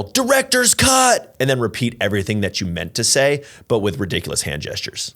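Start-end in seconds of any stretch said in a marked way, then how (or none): 0.0-1.4 high, drawn-out shouted announcement; yell. Pretend. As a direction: belt it out slowly and loud. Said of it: "just unmute and yell".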